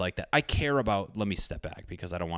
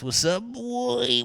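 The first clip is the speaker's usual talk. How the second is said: high, slow taunt; mock taunting call, much higher; much slower than usual. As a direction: goad him with a high drawn-out call. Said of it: "so he comes smacks his ass".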